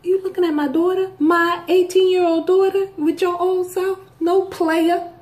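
high, sassy mother warning a man off; shooing off a man twice her daughter's age; mock protective mom. Mom scolding an older man for eyeing her daughter.

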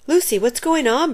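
high, urgent question; cried out. A narrator's reading. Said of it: "Bradley cried".

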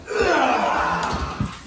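loud effortful grunt lifting; straining grunt. Real, not acted. Carrying heavy mattress upstairs.

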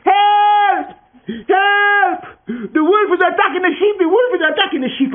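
high, loud panicked cry for help; yelling for help. Acted. Boy screaming to the village for help, panicked shout. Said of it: "yelled down to the village".

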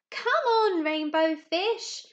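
high, loud, playful call, slowing on rainbow fish. Acted. Other fish calling the rainbow fish to play, high loud call. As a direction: call across the sea to your friend. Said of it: "they would call".

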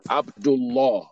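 Abdullah said clearly as "ab-dul-LAW"; heavy Lam. Shown as a demonstration. Teacher saying it for the class to repeat, heavy Lam. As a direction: give Abdullah a rounded, heavy last syllable. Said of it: "that Lam will pronounce the law".

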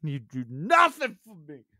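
high mock rant building into a scream; comic yelling. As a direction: play a hurt partner, escalating to screeching. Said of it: "like, yelling every episode".

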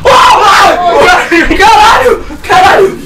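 high panicked swearing; scared horror game streamer. Real, not acted. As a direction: yelp in fright at a jump scare.

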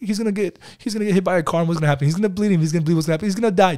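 playful rapid Q and A; quick lively back and forth. Retold or imitated. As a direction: rattle it off like an eager quiz. Father and son rapid Q&A.

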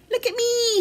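put-on high, playful child's call; shouting. A narrator's reading. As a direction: call out excitedly as a little bear. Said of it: "he shouted".

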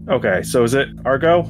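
ordinary talk, nothing marked

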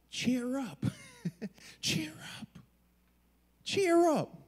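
high, put-on chirpy encouragement; mock cheerful. Crowd suddenly nice to the man they silenced, mock cheerful.

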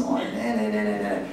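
mock nagging babble; monkey chatter. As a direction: rattle off nonsense like a busy ego. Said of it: "let the monkey chatter".

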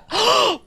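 one sudden sharp gasp of delight; sharp excited gasp. Real, not acted. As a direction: gasp at the sight. Discovers rare peacock on screen.

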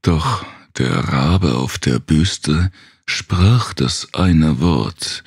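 plain reading voice

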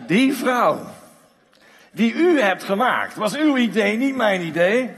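loud, high, accusing Adam; indignant blame. Acted. Adam blaming God for the woman, loud and indignant. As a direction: point the finger: that woman you made.

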